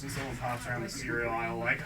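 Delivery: ordinary talk, nothing marked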